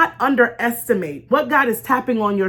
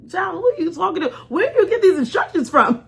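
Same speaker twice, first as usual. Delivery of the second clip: higher, fast incredulous ribbing; mocking onlookers; 6 semitones higher than usual. Onlookers mock Noah building the ark, incredulous. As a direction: tease him like he's lost it. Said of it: "they're like".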